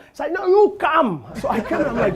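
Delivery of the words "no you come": loud higher summons; calling over. Retold or imitated. Man at the poolside calls him over, loud summons. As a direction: call him over bossily.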